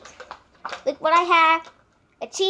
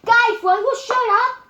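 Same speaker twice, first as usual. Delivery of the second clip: high, loud annoyed yell; angry shout; 7 semitones higher, 8 dB louder than usual. Real, not acted. Yelling at others off camera.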